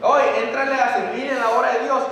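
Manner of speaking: calls out over the room to come and serve; loud call. Acted. Preacher voicing a call to serve God, a loud urging call.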